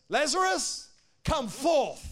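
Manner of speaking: high, forceful commanding call; bold, loud voice. Acted. Preacher as Jesus calling Lazarus from the tomb, bold loud voice. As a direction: boom it out as a command. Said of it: "Bold voice, a loud voice".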